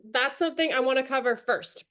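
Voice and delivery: ordinary talk, nothing marked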